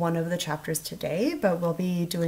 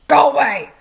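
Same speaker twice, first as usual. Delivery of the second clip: 1 grumpy; 7 semitones higher, 12 dB louder than usual. A narrator's reading. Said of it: "said the voice from a corner of the room".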